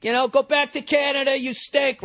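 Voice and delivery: high-pitched jeering from the stands; crowd heckling. Retold or imitated. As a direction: imitate fans taunting a player. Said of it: "everybody's giving Flutie shit".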